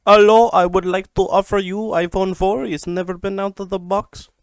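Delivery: put-on high, loud Indian accent street seller; hammed-up Indian accent pitch. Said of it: "trying to do an Indian accent specifically".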